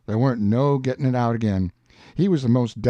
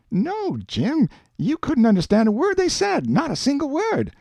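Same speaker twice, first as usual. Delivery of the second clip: put-on high-pitched, emphatic boy's voice in dialect; Huck, insistent; 10 semitones higher than usual. Huck telling Jim about French talk, high and emphatic.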